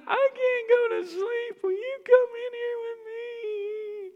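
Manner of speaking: put-on high, slow, tearful child's whine; crying little girl. Daughter begging dad to come in, tearful whine. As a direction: whimper it like a scared little girl.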